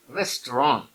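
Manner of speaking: "restaurant" in two syllables, "rest-ron"; French-style pronunciation. Shown as a demonstration. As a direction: end "restaurant" on an open French "on", no final t. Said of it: "it's restaurant".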